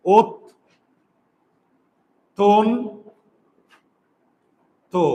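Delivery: "ওত, তন, তো" in separate syllables with long pauses; syllable-by-syllable pronunciation. Shown as a demonstration. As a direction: give each of "ওত, তন, তো" a rounded o. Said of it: "সবগুলাতে ওকার দিয়েছি". ("ওত, তন, তো" থেমে থেমে ও-কারে)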